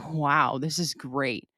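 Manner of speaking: slow, dreamy, lightly content; mock blissed-out calm. To herself early in her meditation, slow and dreamy. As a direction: savor it like everything is peaceful.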